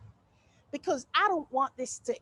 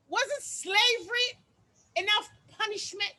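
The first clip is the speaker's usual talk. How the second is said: sassy rant; 7 semitones higher than usual. Acted. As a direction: rant about her hair, pitched up and indignant.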